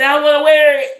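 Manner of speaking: put-on high, loud stubborn child; insistent child. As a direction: demand it like a stubborn kid. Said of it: "And say".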